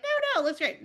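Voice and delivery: high, sing-song fake reassurance; mock reassurance. Parents politely faking praise, in a high sing-song. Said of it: "other parents were like".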